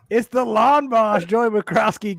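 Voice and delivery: drawn-out hype introduction; slow, loud ring-announcer intro; announcer call. Acted. Announcing a cohost.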